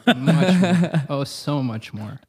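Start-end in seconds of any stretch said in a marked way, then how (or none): 0.1-1.0 louder, laughing through it. Real, not acted. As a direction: laugh out the words. Laughing emphatic repeat.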